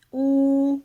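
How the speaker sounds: ऊ held long and loud; teacher stretching out ऊ; long vowel demo. Shown as a demonstration. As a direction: sustain ऊ as a clear long vowel. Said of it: "जब आप ये बोलते हैं".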